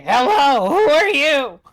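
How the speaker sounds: high, loud in-character hail; calling out. Acted. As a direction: hail a stranger from afar. Character calls after a stranger.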